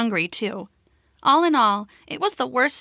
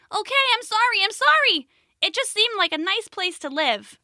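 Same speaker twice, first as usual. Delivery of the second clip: tiny, high-pitched apologetic squeak; much smaller voice; 10 semitones higher than usual. Acted. Caterpillar calling out from inside the cave, small voice. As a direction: plead sorry in a small, high voice. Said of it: "a much smaller voice called out".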